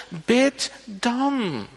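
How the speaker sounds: loud, high, impassioned exhortation; fervent preaching. Acted. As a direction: drive the command home to the congregation. Preacher urging prayer with force. (predikant die de gemeente aanspoort: vurig en luid)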